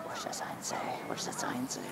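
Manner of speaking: unvoiced whisper. Real, not acted. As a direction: breathe the question softly. Whispering the question.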